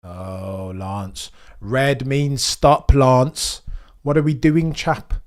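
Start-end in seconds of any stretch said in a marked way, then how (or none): 1.6-5.1 slow, loud scold at the driver. Real, not acted. Streamer yells at driver for running a red light, slow loud scolding. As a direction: spell it out like a stern parent.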